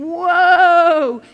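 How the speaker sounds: a loud, long call to a horse; loud whoa. Shown as a demonstration. Horse rider calling the horse to halt, loud. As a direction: rein it in with your voice. Acts out pulling reins.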